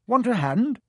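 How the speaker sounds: cheerful twin voice. A narrator's reading. Twin offers help.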